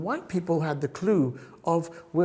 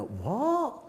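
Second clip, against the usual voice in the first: mock bafflement; 7 semitones higher, slower than usual. Acting classmates baffled by his answer.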